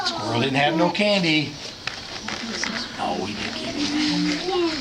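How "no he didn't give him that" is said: squeaky ventriloquist dummy. Acted. Dummy voice corrects story.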